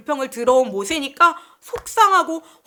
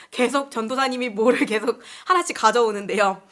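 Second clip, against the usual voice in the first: normal talking voice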